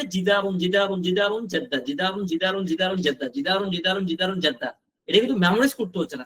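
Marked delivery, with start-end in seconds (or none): 0.0-4.7 chain the words in a steady, unbroken rhythm; free-flowing pronunciation drill. Shown as a demonstration. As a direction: repetitive loop, each round falling on the last word. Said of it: "উচ্চারণটা free করতে হবে কিভাবে?". (সাবলীল উচ্চারণে টানা ছন্দে বারবার বলা)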